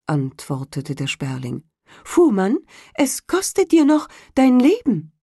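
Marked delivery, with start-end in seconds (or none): 2.0-5.1 high, loud bird voice threatening; menacing sparrow. A narrator's reading. Sparrow threatening the carter's life, high and loud. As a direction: warn him like a vengeful bird.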